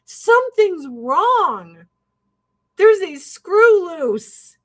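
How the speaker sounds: a mild mock scream of alarmed cops. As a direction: yell it slowly like a cop at the window. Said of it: "cops screaming".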